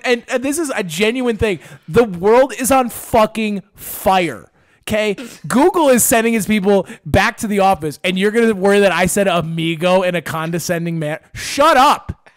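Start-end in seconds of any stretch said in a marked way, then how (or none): 1.9-4.5 "The world is on fucking fire" word by word; slow emphasis. Real, not acted. Comedian justifying not caring, slow exasperated emphasis. 11.3-12.3 higher, louder dismissal; exasperated shout. Acted. Comedian dismissing listeners worried about his wording, loud exasperation.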